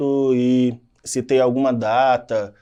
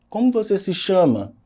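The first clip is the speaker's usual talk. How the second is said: a clearly higher questioning tune; direct question; 8 semitones higher, 3 dB louder than usual. Shown as a demonstration. Teacher showing direct question intonation to the students. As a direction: ask it like you truly want the name. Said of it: "é uma pergunta direta".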